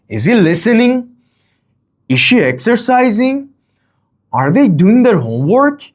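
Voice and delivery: "listening", "exercising" and "homework" lifted high; high-pitched questions, climbing on "listening", "exercising", "homework"; rising question intonation. Shown as a demonstration. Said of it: "rising intonation".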